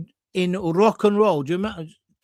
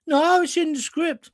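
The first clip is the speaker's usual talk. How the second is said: Brummie cleaner; 10 semitones higher than usual. Retold or imitated. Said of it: "Amy Turtle was the Brummie cleaner".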